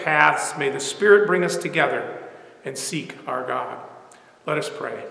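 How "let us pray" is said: ordinary delivery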